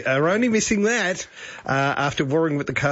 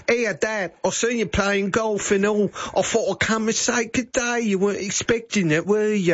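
higher-pitched mock English accent; 6 semitones higher than usual. Radio host imagines daughter greeting her dad back from London, mock English accent. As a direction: put on a playful English accent. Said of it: "came back with an English accent".

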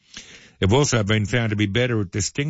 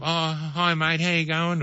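funny dog voice; 6 semitones higher than usual. Retold or imitated. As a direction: talk to the excited dog like a baby. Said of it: "we put on that funny dog voice".

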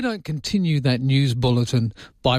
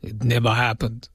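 mock Russian denial; 3 semitones lower than usual. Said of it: "they said in Russia".

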